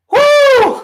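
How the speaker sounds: long, high, loud whoop; piercing post-set whoop. Real, not acted. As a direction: let rip a big "woo". Exertion whoop after set.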